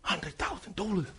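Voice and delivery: Hundred thousand dollars dropped to a near whisper; hushed repeat. Shown as a demonstration. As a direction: let the price sink in, barely audible. Price repeated in hush.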